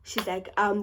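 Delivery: normal talking voice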